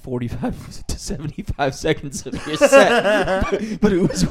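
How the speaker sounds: laughing through the quote, pitch rising; can barely finish for laughing. Real, not acted. Quoting the girl, cracking up.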